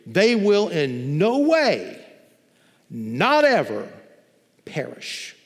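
slow, weighty preacher's emphasis; emphatic double negative. Shown as a demonstration. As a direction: lean hard on every negative, slowly. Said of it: "this actually reads in the Greek".

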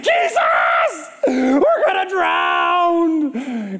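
high drawn-out wail of fear; desperate cry. Acted. Disciples praying in terror on the boat, slow high wail. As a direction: wail it slowly like you're drowning. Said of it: "they were praying".

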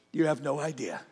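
soft, low repeat; quietly said again, earnest; hushed. Real, not acted. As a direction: drop it to a hush to let it land. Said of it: "Look at me".

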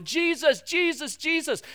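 high insistent calling after Jesus; pleading to get his attention. Retold or imitated.

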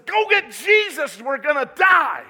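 high, loud, desperate yell; frantic cry of a drowning sailor; panicked shout. Acted. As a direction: shout it like the boat is sinking. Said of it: "one of them says".

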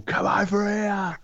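high, drawn-out hollered call; mock drunken scream. Imagining boozy Phil yelling at people, mock screaming in Northern accent. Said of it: "and then just screaming at people".

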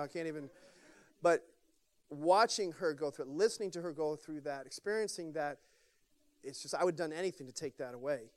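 choked up. Real, not acted. Pastor choked up about daughter.

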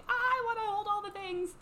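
high loud eager squeal; excited. Retold or imitated. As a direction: gush like an eager kid. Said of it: "she was like".

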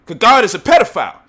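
loud, high declaration; loud accusation; ranting shout. Real, not acted. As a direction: shout it as the punchline. Heated rant climax.

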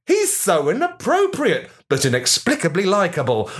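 ordinary delivery

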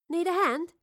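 high, perky question; bright, lifted voice; eager offer. Acted. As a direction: pipe up to offer help. Astrid eagerly offers help.

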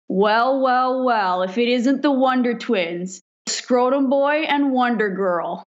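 slow, teasing "well, well, well" of a smug captain; mocking captain. Acting captain greeting the grinning rookies, slow and mocking. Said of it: "he looks at us and he goes".

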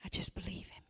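hushed breathy whisper of faith. Real, not acted. Preacher whispering the refrain to the church. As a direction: drop to a whisper for the last one.

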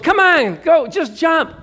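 high, loud child's yell, imitated; impatient little kids. Four and five-year-olds urge a jumper, mock yelling. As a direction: pipe up like a pushy small child. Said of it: "four and five-year-olds".

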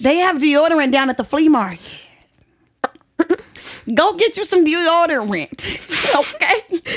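heated rant. Real, not acted. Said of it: "You're pissed off so bad".

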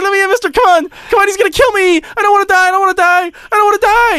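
high-pitched mock-desperate kid voice; pleading little kid. Trolling kid tells the player he'll be killed, mock-desperate pleading. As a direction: imitate a kid begging for his life. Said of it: "he was like".

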